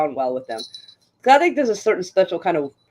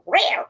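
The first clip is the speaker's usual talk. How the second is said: a light, higher meow retold; imitated meow; 7 semitones higher than usual. Retelling the nurse's odd meow to a friend, lightly imitated. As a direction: mimic the nurse's cat noise casually. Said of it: "kind of sound".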